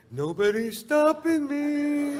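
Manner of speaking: very high, slow, far-off gloating voice; triumphant strut. Retold or imitated. Comic striding up the yacht gangplank, high slow gloat. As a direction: swagger like nobody can stop you. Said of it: "walking on the gangplank going".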